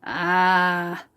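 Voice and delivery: slow, satisfied giant voice; a hungry giant admiring his catch; lightly voiced greedy giant; gloating giant. A narrator's reading. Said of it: "said the giant".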